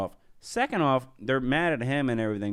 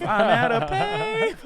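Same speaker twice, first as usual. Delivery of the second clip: laughing; slower than usual. Retold or imitated. Mimicking, breaks into laugh.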